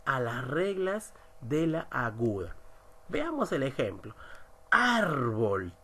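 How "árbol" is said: Árbol with a strong, long "Ár"; stress on penultimate syllable. Shown as a demonstration. Teacher showing a grave word, Árbol stressed on "Ár". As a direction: hit the first syllable of Árbol, then drop. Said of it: "La mayor fuerza de ar".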